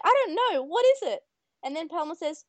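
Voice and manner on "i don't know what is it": high, louder, cheerfully clueless; clueless girl. Retold or imitated. Mimi asking her Digimon friend, high and clueless. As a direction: ask it brightly, not knowing a thing. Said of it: "And Mimi's like".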